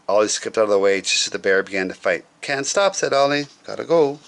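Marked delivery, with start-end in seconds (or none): 2.4-4.2 higher, quick cheerful dash-off; breezy hurry. Acted. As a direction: chirp it while rushing off. Ollie hurrying away.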